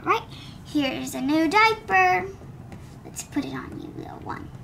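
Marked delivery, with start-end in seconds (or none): 3.0-4.5 whisper. Acted. Putting diaper on baby doll.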